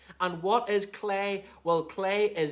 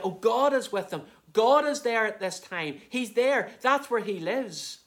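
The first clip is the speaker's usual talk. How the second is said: higher, louder exclaiming; acted excited onlookers; 6 semitones higher, 5 dB louder than usual. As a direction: imitate amazed witnesses pointing out where God is. Acting out onlookers pointing at glory.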